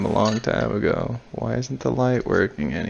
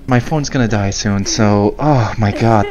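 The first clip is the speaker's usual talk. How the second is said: usual speaking voice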